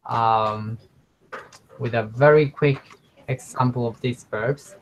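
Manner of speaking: ordinary talk, nothing marked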